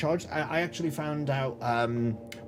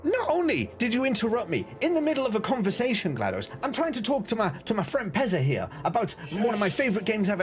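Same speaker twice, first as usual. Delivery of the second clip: mock outrage; 8 semitones higher than usual. Scolding the stream bot GLaDOS.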